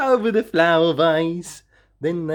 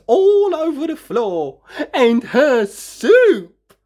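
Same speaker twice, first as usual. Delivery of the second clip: dismay; 9 semitones higher, 5 dB louder than usual. A narrator's reading. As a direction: drag out the last word in despair. Dismay at the soup mess.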